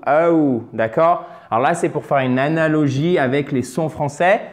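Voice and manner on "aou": aou gliding from a strong first sound into a lighter ou; drawn-out double sound in aou; diphthong demo. Shown as a demonstration. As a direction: slide aou slowly towards OU. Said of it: "on va vers le OU".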